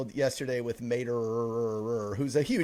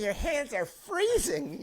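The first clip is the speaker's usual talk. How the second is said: much higher, slow put-on voice; mock character voice; 12 semitones higher, slower than usual. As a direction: squeak it slowly like the movie character. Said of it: "Harry, Harry".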